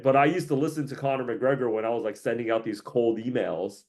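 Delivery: normal talking voice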